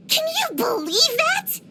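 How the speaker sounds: slow, high-pitched incredulity; outraged disbelief. Acted. Seeking sympathy over a rival's trick, outraged. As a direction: demand agreement, pitch way up.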